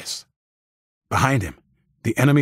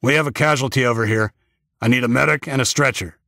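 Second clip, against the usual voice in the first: urgent call for a medic; slightly raised, businesslike call for help; 3 semitones higher than usual. A narrator's reading. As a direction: call it out to the team. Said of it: "immediately called out".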